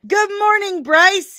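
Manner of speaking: high, loud sing-out hello; called-out greeting. Real, not acted. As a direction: sing out a big greeting to a viewer. Host calls greeting to chat viewer.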